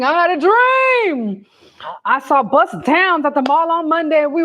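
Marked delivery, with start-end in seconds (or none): 0.0-1.4 slow, high preacher's cadence; Martin Luther King. Retold or imitated. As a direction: draw it out like a civil rights sermon. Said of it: "Like Martin Luther King".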